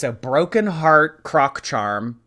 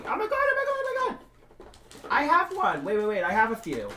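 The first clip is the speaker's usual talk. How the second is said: shrieking jackpot winner; 12 semitones higher, 7 dB quieter than usual. Real, not acted. Frantic search finding Croc Charms.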